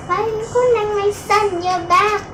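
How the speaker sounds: high, loud cry of a mother's joy; joyful scream. Acted. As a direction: scream his name with love. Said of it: "she screamed with love".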